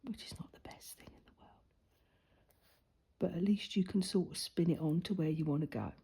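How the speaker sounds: soft unvoiced whisper; half-whisper, as if not to disturb. Real, not acted. Whispered aside about tablet.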